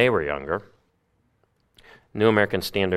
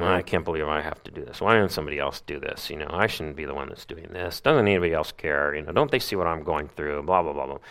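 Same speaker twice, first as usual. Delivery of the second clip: soft fast muttered griping; mock grumbling; 5 semitones lower, 6 dB quieter, faster than usual. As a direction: grumble under your breath. Grumbling to oneself.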